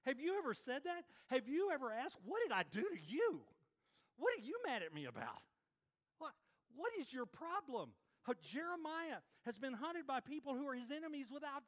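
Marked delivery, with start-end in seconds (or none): 2.2-8.0 mock exasperated. Voicing someone wrongly hunted.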